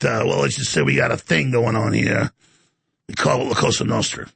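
put-on low mobster-style New York accent. Host pretending to fit in in New York, low Brooklyn mobster accent. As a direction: play a Sopranos wiseguy from Brooklyn, pitched well down. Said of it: "I go to New York".

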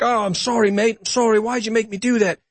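high, pleading mock sob of an apology; imitating near crying. Retelling a dying prisoner's killer apologizing, high and mock-tearful. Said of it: "he's almost crying".

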